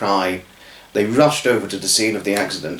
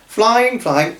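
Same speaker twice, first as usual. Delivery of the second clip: gleeful flying; 10 semitones higher than usual. A narrator's reading. Narrating Emmett flying.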